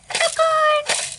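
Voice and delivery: very high, loud delighted squeal; gleeful cry. Real, not acted. Announcing popcorn popping.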